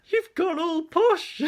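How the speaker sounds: very high, loud, drawn-out mock shriek; imitating astonished friend. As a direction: squeal it slowly in mock shock. Friend astonished at new accent.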